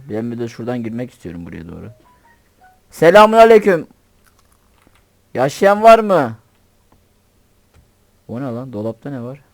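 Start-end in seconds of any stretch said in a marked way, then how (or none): 2.9-6.4 calling out. Real, not acted. Entering house, calling to inhabitants.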